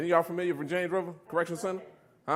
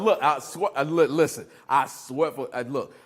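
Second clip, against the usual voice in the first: normal talking voice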